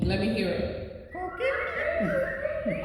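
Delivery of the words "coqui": high chirpy frog call, ko-KEE; coqui frog call. Shown as a demonstration. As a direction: chirp it like a tiny tree frog. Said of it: "you have to do your best impression of the coqui".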